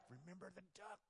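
sly unvoiced threat; whisper. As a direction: hiss it secretly. Sister whispers blackmail to brother.

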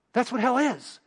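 normal talking voice

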